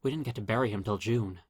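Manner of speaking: ordinary delivery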